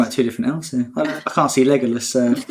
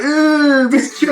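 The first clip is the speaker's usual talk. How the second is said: mock coy celebrity; 13 semitones higher, 5 dB louder, much slower than usual. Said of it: "He's like".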